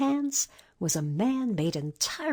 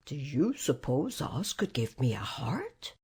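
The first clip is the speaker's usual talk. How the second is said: lower, hushed, hopeful whisper; wistful whisper; 6 semitones lower, 5 dB quieter than usual. Acted. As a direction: whisper the hope as if afraid to ask. Tin Man's wistful question.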